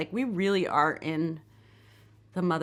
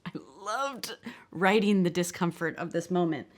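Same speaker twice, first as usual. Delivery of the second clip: ordinary delivery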